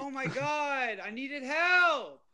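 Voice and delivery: high, slow whiny complaint; exasperated whine. Real, not acted. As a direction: drag it out in disbelief. Frustrated after dying.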